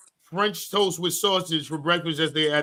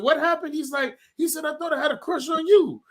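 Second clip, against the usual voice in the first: put-on higher voice for the little boy; high kid voice; 9 semitones higher than usual. Dad retelling what the neighbor boy told him, in a higher kid voice. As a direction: pitch it up like a small kid. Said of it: "he was like this".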